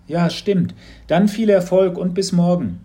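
a hint of an eager boy; lightly voiced boy, eager; young boy Mats. A narrator's reading.

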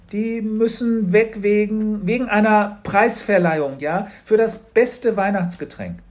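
put-on child making up an excuse, flustered and slow; flustered fib. A narrator's reading. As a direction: drag it out while inventing the lie. Child inventing a flimsy excuse.

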